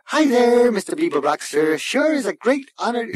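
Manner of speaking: very high, eager machine voice; fawning computer. A narrator's reading. Computer flatters Zaphod Beeblebrox, chirpy and fawning.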